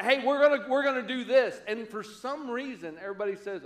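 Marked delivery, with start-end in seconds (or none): 0.0-1.6 put-on bossy playground kid. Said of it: "he gathers everybody around and they say".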